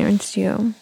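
ordinary talk, nothing marked

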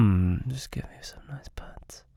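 ordinary talk, nothing marked

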